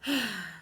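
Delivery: soft tired sigh. Real, not acted. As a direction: let out a weary sigh. Sighs after recalling tension.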